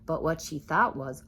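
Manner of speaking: usual speaking voice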